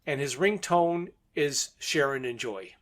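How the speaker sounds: normal talking voice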